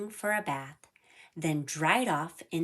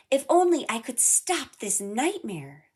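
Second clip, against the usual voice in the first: higher, louder desperate wish; desperate thought; 6 semitones higher, 5 dB louder than usual. A narrator's reading. Sora trapped as a tree, desperate inner cry. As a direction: plead to wake up from the nightmare. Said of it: "thought Sora".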